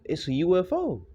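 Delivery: lightly hammed-up ecstatic announcement; mock excitement. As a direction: play the guy thrilled by his find. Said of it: "he was going all excited".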